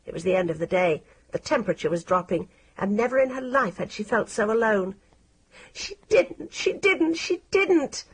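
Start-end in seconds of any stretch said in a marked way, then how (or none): 5.7-8.0 high, near-crying repeated protest; desperate girl insisting against the rumour; tearful denial. A narrator's reading. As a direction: choke it out through tears. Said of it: "Maggie thought".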